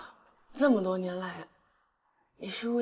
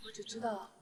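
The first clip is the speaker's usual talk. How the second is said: soft whisper; 9 dB quieter than usual. Acted. Drunk girl whispers her love.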